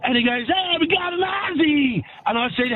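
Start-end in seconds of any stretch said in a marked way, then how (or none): 0.5-2.0 higher, mock shout of a street vendor; hollering vendor. American vendor hailing the Aussie customer, hollered in imitation. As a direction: call it out like a hot dog seller.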